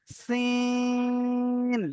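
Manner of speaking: সিন with the সি held long, like সিডি; long sin. Shown as a demonstration. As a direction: stretch সি before ন. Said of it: "সিডির মতো উচ্চারণ হবে". (সি-টা টেনে সিন)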